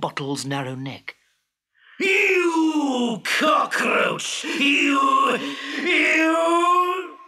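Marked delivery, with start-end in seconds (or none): 2.0-7.2 high, slow, shouted insult; spluttering genie. Acted. Genie spluttering at Abdullah's mockery. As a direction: sputter with rage, lost for words.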